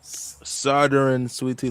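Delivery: "Southern" stretched slowly in his own drawl, "Sou-thern"; careful slow demo of "Southern sweet tea". Shown as a demonstration. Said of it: "some what?".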